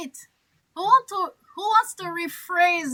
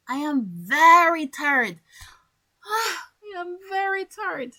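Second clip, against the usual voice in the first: louder very, then a sighing weary I am very tired; 4 semitones higher, 3 dB louder than usual. Shown as a demonstration. Teacher acting out being very tired for students. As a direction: sigh it out, exhausted. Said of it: "But if I say".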